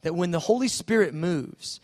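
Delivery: normal talking voice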